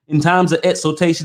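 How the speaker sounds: ordinary delivery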